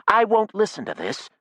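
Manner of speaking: high, indignant outcry; cried out. A narrator's reading. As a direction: Jackie leaving the heated meeting. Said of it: "Jackie cried".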